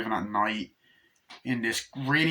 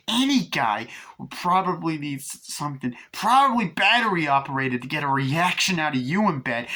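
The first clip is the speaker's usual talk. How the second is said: mocking retort; 6 semitones higher, 4 dB louder than usual. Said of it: "And Chucky's like".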